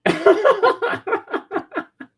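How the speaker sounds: high, wheezing laugh fit; helpless high laughter; high burst of laughter. Real, not acted. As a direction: let the laugh break high. Cracking up at joke.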